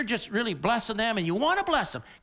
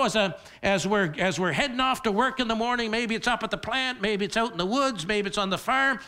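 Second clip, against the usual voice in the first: ordinary delivery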